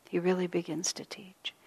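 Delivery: He really begins to teach whispered softly, with a rise on really; whispered repeat. Shown as a demonstration. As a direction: breathe the repeat as a soft whisper. Repeats line, second time whispered.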